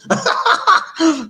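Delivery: high, loud burst of laughter; hearty laugh. Real, not acted. Host amused at Mama Sita's new name, laughing heartily. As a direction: break out laughing.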